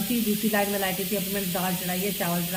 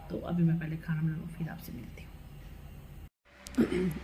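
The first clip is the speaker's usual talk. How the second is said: whisper; 3 semitones lower, 8 dB quieter, slower than usual. Real, not acted. Signing off while cooking.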